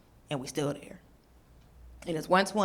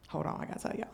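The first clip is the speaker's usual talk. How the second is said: hushed, confiding aside to the crowd; whispered aside; 8 semitones lower, 13 dB quieter, faster than usual. Real, not acted. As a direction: lean in and whisper it.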